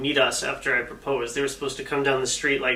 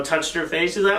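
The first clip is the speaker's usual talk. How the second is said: ordinary talk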